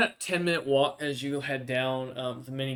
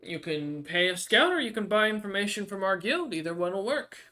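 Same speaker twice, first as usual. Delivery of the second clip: lightly voiced dwarf woman, raised pitch; young dwarvish barmaid, businesslike; 6 semitones higher, 3 dB louder than usual. Barmaid offering scout or guild information, lightly voiced and higher.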